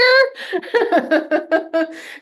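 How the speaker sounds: high burst of laughter; laughing at the husband joke; loud high laugh. Real, not acted. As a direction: laugh hard at your own joke. Laughing at her own joke.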